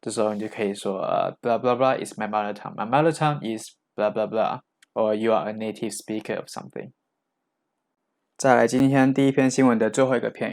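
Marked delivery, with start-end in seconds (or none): none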